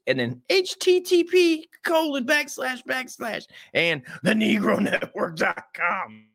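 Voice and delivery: very high, slow spelling of the address; mock read. Spelling out the rival's website in a slow, high mock voice. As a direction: drag it out high and slow like a parody.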